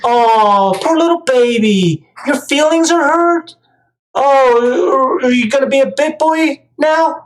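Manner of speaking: high, sing-song mock coddling of a whiny baby; mocking baby talk. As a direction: coo at them like a crying infant, dripping sarcasm. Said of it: "Treat 'em like babies".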